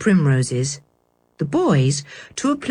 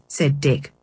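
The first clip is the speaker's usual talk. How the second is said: usual speaking voice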